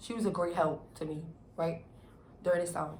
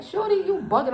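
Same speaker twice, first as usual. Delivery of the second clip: high, louder mock challenge; retold confrontation; 11 semitones higher, 6 dB louder than usual. Telling the girl off in the shelter, high and loud. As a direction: call her out, voice jumping up.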